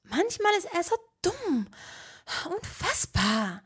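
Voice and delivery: high exasperated voice; exasperated Hermine. A narrator's reading. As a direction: vent about him.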